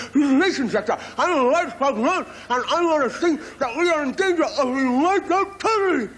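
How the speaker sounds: very high, stretched wide-mouth frog voice. Acted. Frog spokesman pleading with Ian Smith, in a high wide-mouthed voice. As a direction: plead like a pompous little frog with a huge mouth.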